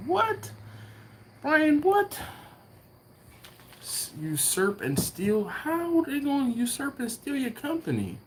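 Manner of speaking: high, incredulous what?; can't believe what he's reading; real shock at a friend's bad news; stunned disbelief. Real, not acted. Reacting to chat about lawyers.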